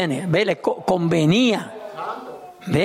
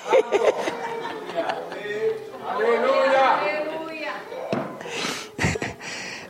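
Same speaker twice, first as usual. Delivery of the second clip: long laugh; 7 semitones higher, 6 dB quieter than usual. Real, not acted. Laughing at own sketch.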